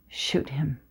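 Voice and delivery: low, soft, quiet command; father gently handing over the gun; hushed tender order. Acted. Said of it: "says gently".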